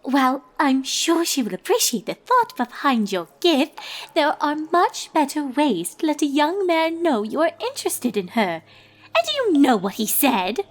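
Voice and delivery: high, prim, gossipy lady unicorn; a refined fashionista dishing gossip, pitched high; Rarity. Acted. Rarity gossiping to her friends.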